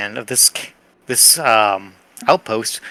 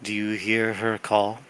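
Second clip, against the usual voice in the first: eerie plant chorus; 4 semitones lower, 6 dB quieter, slower than usual. Acted. Plants call the character.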